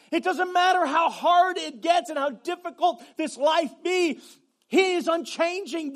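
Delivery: loud, rising preacher's shout; preaching at full force. Acted. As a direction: build to a fervent shout.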